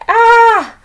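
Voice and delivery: high loud startled "Ah!"; scared yelp. Retold or imitated. Boy recalls seeing August, loud shriek. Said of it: "I was like".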